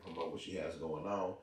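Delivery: ordinary talk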